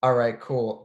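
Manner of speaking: ordinary talk, nothing marked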